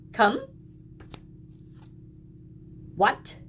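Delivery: a teacher leading a sight-word drill. Acted. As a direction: call each word out crisply for children. Reads tricky words to class.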